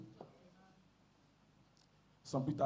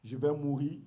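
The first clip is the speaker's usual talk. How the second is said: scripture reading; 3 semitones lower, slower than usual. Acted. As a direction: read the verses slowly and reverently. Reads scripture aloud.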